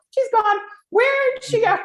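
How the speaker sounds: high, loud mock alarm at losing your mind; mock panic. On camera, imagining you lost your mind, mock panic. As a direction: gasp it out like your mind just left the room. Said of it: "You're gonna go".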